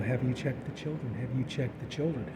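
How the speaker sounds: quiet sinister repeated question; creepy caller. Retold or imitated. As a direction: murmur the threat into the receiver.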